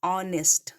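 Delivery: "ऑनेस्ट" in two careful syllables, aw-nest, no h; correct pronunciation. Shown as a demonstration. Teacher answers her own question for students, silent-h "ऑनेस्ट". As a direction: sound out "ऑनेस्ट" from the vowel, h silent. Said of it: "क्या पढ़ेंगे".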